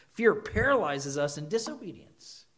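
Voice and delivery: normal talking voice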